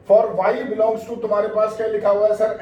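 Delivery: ordinary delivery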